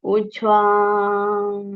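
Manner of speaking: slow exhale cue on breath; drawn-out exhale. Acted. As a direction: let the word flow out.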